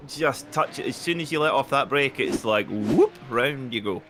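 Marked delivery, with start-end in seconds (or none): 2.7-3.1 quick swooping "whoop" as a car spins; light cartoon swoop; whoop noise. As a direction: mimic a sudden slide with your voice. Imitates the car spinning round.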